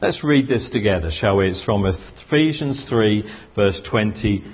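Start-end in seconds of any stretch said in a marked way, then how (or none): none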